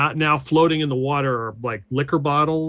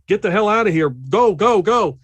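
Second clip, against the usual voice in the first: louder, raised urgent order; mock urgent shout; 6 semitones higher, 5 dB louder than usual. Gonzalez with arm on fire ordering retreat, loud urgent. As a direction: bark them out, hurried. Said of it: "He's like".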